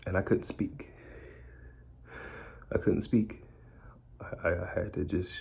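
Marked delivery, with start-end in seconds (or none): none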